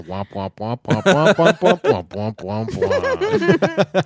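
nonsense "womp womp" human speech as a dog hears it; imitated garbled talk. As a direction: drone meaningless womps through laughter. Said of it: "just hearing you go".

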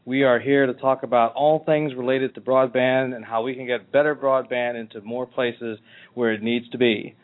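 ordinary talk, nothing marked

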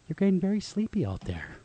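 soft, flat hypnotist drone; soft monotone voice. Shown as a demonstration. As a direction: lull them to sleep monotone. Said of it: "a very nice, soft, monotone voice".

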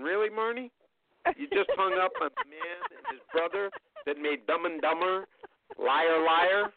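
half-mock exasperation at his daughter; retold disbelief.

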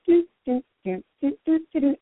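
high-pitched imitated game bleeps; sung-out imitation of coins being collected; video game coin sounds. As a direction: chirp little high "doo" notes like a game. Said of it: "sometimes it's like".

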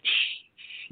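unvoiced shushing; quiet her down with a hiss; hush. [name] hushing Lana in the entry, whispered shush.

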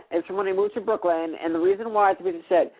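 ordinary talk, nothing marked

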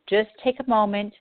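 ordinary talk, nothing marked

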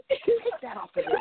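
mock scold. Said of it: "I'm like".

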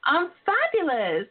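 ordinary talk, nothing marked

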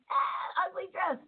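high, yelping mock cry that falls away soft; mock shocked outburst. Host mimics guest's blunt remark as a high mock squeal. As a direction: replay his comment as a half-laughing squeal. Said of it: "I exploded when you said".

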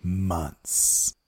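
spooky voice. Pretend. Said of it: "It's my spooky voice".

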